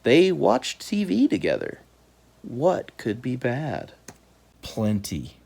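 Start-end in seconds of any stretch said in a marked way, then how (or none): none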